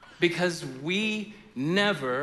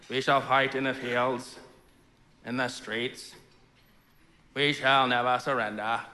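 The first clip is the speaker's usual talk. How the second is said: real Churchill, subdued; 6 semitones lower than usual. Retold or imitated. Pastor imitating the real speech, low and understated. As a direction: mutter the lines flatly, no rally. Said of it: "if you go listen to the speech, it's like".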